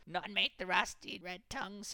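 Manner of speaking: high, slow, hissing creature voice; hissed. A narrator's reading. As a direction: hiss it out in warning. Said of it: "hissed Gollum".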